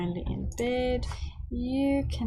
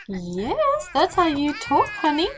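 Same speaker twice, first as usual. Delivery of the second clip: put-on higher and louder, cheering a toddler on; mock-parent praising her toddler, higher and louder; proud praise; 6 semitones higher, 7 dB louder than usual. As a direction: beam it at the little one.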